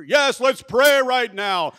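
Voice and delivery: slow, high bellowed prayer; mock-thunderous, drawn-out praying; very loud deacon praying. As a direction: shout the prayer for all to hear. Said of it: "starts praying over me like this".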